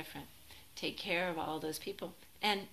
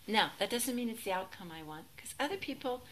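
ordinary talk, nothing marked

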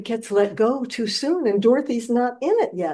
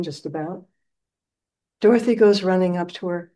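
ordinary delivery

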